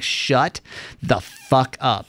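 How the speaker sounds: slow, spaced-out word by word; emphatic retort. Acted. Host answering whiners about mild cold, slow mock-aggressive retort. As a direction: drag out each word for effect.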